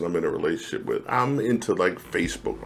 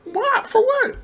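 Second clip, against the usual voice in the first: much higher, incredulous; can't believe it bothers them; baffled outrage; slower than usual. Real, not acted. Telling a friend why exes minded his Facebook fights, baffled and very high.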